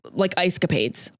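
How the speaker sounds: ordinary delivery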